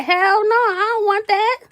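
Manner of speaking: high, loud outraged no; retold loud refusal. Guest refusing host's macaroni again, loud and outraged. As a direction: throw the no back, appalled.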